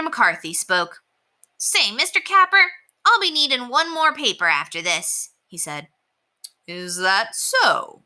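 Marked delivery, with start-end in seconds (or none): 1.6-5.3 put-on high, eager young boy; boy's voice. Boy Scooter speaks up. 6.7-8.0 slow, interested question; sounding interested. Acted. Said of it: "Mister Capper sounded interested".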